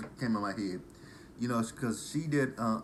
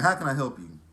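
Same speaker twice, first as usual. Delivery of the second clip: loud, raised drive-thru greeting with a rude edge; loud drive-thru worker; 5 semitones higher, 8 dB louder than usual. Retold or imitated. Imitating the drive-thru girl at Church's, loud and rude. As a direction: bark the order question like a bored cashier. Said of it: "the girl said".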